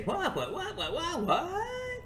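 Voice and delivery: high, rising dim-witted what-huh; mocking confused viewers. Mocking slow viewers puzzled at his story, high baffled squeaks. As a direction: play a baffled dullard squeaking questions. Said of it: "What in the world does it mean to say".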